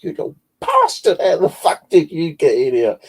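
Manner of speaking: shrieking high and loud at the cat; startled angry shout. Retold or imitated. Woman startled in bed yells at her cat, shrieking. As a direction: yell at the cat that ambushed you. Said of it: "I went".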